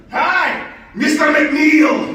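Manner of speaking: loud, slow, high Scottish brogue. Acted. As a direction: belt it out in a thick Scottish accent. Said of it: "in his thickest Scottish brogue".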